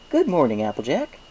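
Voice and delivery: cheerful baker. Acted. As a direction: greet her with a big smile. Baker greets Applejack.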